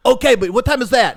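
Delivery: high, loud impatient questioning. Retold or imitated. Scammer being put off until after work, impatient. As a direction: imitate someone losing patience.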